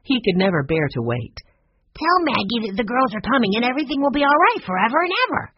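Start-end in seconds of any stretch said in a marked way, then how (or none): 1.9-5.5 put-on high, fast child voice; impatient little boy. A narrator's reading. Benny wants grandfather to telephone, high excited rush.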